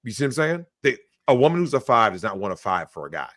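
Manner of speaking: usual speaking voice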